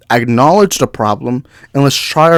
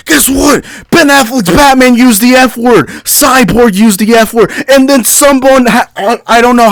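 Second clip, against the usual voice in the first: higher, pointed comeback; heated retort; 8 semitones higher, 3 dB louder than usual. Real, not acted. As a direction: fire back at the critics. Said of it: "I'm sorry if I'm yelling".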